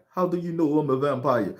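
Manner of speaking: lightly voiced vampire asking back; a touch of a different voice; hint of a vampire voice. Vampire replies, timbre shifts.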